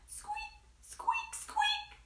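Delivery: very high, soft imitated squeak; mouse squeak. Mouse answering duck with a tiny squeak. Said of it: "said Mouse".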